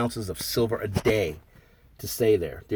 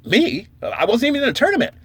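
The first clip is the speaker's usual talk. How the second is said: indignant surprise; 12 semitones higher, 3 dB louder than usual. Retold or imitated. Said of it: "I'm like".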